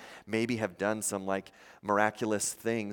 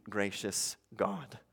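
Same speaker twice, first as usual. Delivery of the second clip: ordinary talk, nothing marked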